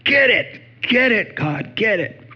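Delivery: high, eager rooting on; excited cheer. Retold or imitated. Pastor cheering on chemo against his cancer, excited. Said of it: "Inwardly, I'm like".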